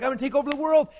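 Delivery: high, pumped-up boast; mock fired-up kid. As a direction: shout it like you can't be stopped. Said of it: "you get fired up, like".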